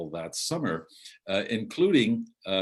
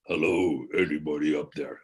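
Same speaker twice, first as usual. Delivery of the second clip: lightly imitating a deep booming visitor calling up the stairs; a hint of a deep stranger's voice calling up from below; 4 semitones lower than usual. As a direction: visitor calls up to the loft in a slightly deeper voice. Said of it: "even deeper than my voice".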